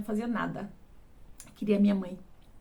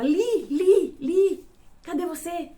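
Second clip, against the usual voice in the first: higher, slower sing-song call with a laugh in it; creepy calling; 7 semitones higher, 3 dB louder, much slower than usual. Retold or imitated. Man searching the dark room for the girl, creepy calling. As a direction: call sweetly while hunting her.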